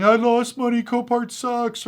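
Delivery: high, drawn-out whiny complaint, mocking; mock crying whine. Buyers mocked for whining about losses, mock crying. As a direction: whine it like a sore loser. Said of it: "like, crying".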